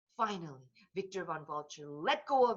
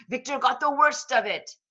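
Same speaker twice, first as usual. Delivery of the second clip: ordinary delivery, nothing marked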